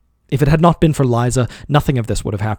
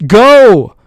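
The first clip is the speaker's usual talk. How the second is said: very loud, much higher shriek; 10 semitones higher, 12 dB louder than usual. A narrator's reading. Master shrieking at his servant, very loud. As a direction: shriek it, clutching him. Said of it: "I shrieked".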